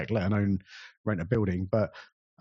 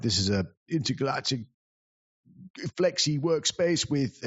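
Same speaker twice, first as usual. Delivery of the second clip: posh buzzword voice; 3 semitones higher than usual. Retold or imitated. Host answering the heckler with jargon, lightly hammed-up posh voice. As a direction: puff up the words like a pompous host. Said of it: "that's how I answered him with".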